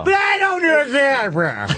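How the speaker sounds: high, slow shouted blurt falling away; a flustered caricature caught by a question; hammed-up high wail collapsing on "bro"; Bobby Kelly impression. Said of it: "Norton's Bobby Kelly".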